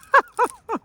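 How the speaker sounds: high, loud laugh; burst of laughter. Real, not acted. Gardener laughs at the state of her plants, high loud laugh.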